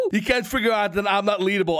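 ordinary delivery

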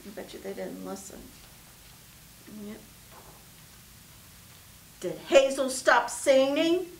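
plain reading voice

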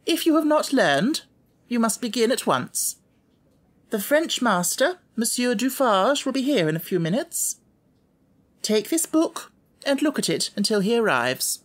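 high, firm and curt; stern headmistress. A narrator's reading. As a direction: give orders that end the matter. Miss Minchin voiced.

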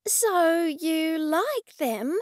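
high, slow, tentative asking; meek question. A narrator's reading. Artist asks the gallery owner for his verdict, meekly. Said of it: "Cressida asked meekly".

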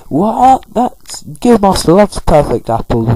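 high, loud mock-scandalised outburst; hammed-up indignant squawk; annoyed outrage. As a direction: start on a high shocked "What?", then scold. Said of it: "Chatot's getting really annoyed".

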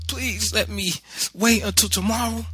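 put-on high, pleading child's whine; begging. A narrator's reading. Crying boy on the sidewalk to mother, high begging. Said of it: "I begged".

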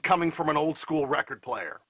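rounded lo-fi voice; mids-only tinny voice; old record player effect. Shown as a demonstration. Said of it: "take a listen to what that sounds like".

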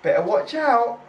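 high, slow hammed-up warning; mock announcer. Quoting his own line at the goal, mock announcer. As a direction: drag it out like a hype man. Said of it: "I said".

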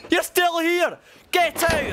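very high, outraged yell; indignant shout. Pretend. Man changing to those still watching, high indignant shout. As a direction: shriek at someone who has not left. Chasing lingering onlookers away.

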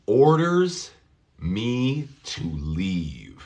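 The king orders me to leave, very slow, each word apart; slow demo. Shown as a demonstration.

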